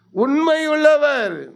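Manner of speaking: high, very slow, each word drawn out; emphatic slow answer. Acted. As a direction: proclaim it slowly. Preacher answers own question. (மெதுவான அழுத்தமான பதில்)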